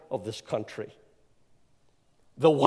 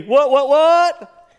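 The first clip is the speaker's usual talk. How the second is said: high, drawn-out "what, what, what"; loud, high, slow hollered catchphrase; playful shout; 12 semitones higher, 14 dB louder, much slower than usual. Acted. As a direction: whoop it out loud, stretching the last one. Playful shout naming golf feat.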